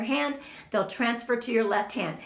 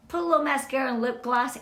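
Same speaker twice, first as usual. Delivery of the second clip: ordinary talk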